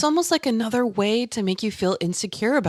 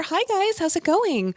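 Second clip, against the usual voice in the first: much higher, sweet service voice; higher customer service voice; 11 semitones higher than usual. Shown as a demonstration. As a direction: brighten up for older customers. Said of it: "I'll go a little bit higher up here".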